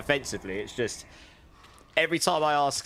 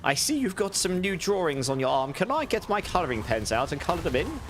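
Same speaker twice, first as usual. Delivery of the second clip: put-on higher, plummy posh British accent of an old nan; 6 semitones higher than usual. Nan offering to colour in grandson's tattoo, posh British accent. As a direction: imitate nan fussing sweetly in a Queen-like British accent. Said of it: "that's exactly how she spoke".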